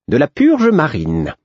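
high, slow, teasing jester; drawn out, knowing and odd; the Fool's voice. A narrator's reading. The Fool answers, voiced high.

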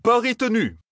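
very high-pitched cry; emphatic high call. A narrator's reading. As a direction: seal the bet with a cry. Bet loudly accepted after earlier cry.

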